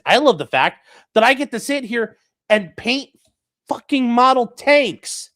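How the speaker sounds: high, loud, dragging out model tanks; excited. Real, not acted. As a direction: gush with rising excitement. Excited rant about hobbies.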